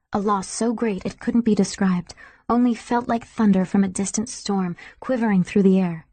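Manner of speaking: ordinary delivery, nothing marked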